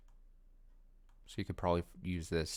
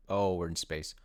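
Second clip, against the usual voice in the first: ordinary delivery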